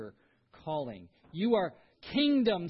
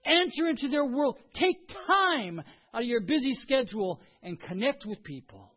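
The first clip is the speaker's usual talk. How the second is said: loud, raised preaching; fervent sermon peak; preaching at full force; 7 semitones higher, 6 dB louder than usual. Acted. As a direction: drive the point home from the pulpit. Preaching climax.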